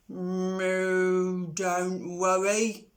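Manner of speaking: slow, steady, kindly cow. Acted. Cow reassures Pig.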